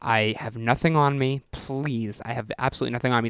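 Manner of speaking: mild, understated begging; retold flat-out plea. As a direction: plead quietly, trailing down. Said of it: "said flat out".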